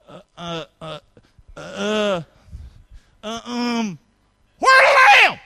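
hesitant uh-uh-uh, then a high shrieking "where the lamb?"; stammering then squeaky yell. Pretend. Preacher hamming up Isaac's stammer and squeaky cry. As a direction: stall nervously, then blurt it out high and loud. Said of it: "here's the way we say it".

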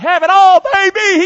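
loud high brag, have it all; mock boast. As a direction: crow it out like a spoiled king. Preacher mocks king's excess.

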